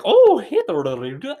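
mock a Swedish girl's bouncy, up-and-down accent. Mocking how hot Swedish girls talk, in a high, sing-song Swedish accent. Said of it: "That's how they sound".

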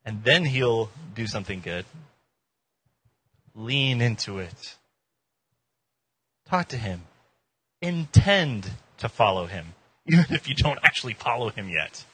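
ordinary talk

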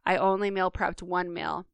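usual speaking voice

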